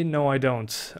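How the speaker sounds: ordinary talk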